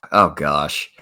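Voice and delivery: sighing, very soft and low; resigned sigh. Retold or imitated. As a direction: let the gosh out on a weary sigh. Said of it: "That was kinda my reaction".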